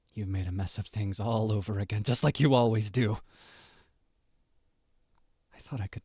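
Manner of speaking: clingy, obsessive lover voice; guilt-trip them like a jealous partner; possessive yandere. Acted. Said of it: "P O V".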